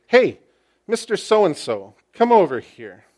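a high, loud hail on Hey, then firm; intentional call. Acted. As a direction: call him over with great intention. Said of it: "That is with great intentionality".

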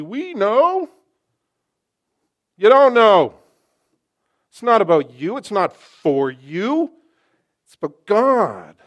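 loud, forceful pulpit declaration; preacher booming over the pews; full-force preaching. Acted. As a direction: hammer the point home to the congregation.

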